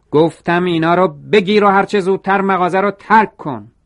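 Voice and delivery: high, loud angry order; shouting again. A narrator's reading. Mr. Sasaki orders Toro out of the shop, shouting. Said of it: "دوباره داد زد".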